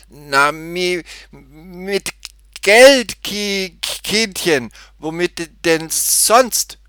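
high, loud stuttering, words bursting out; agitated stammer. A narrator's reading. Cashier tells the girl what to pay with, exasperated stutter. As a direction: force each blocked word out high and loud. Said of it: "stotterte er".

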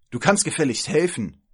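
loud, high-pitched scolding order; furious. Acted. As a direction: demand help angrily. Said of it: "sagte sie wütend". (wütend und fordernd)

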